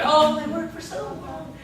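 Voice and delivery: high, hammed-up movie romance voice; mock romantic swoon. Preacher sending up a romance film, mock swooning. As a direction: gush it high, sending up a love story. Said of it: "it sounds like something like in The Notebook".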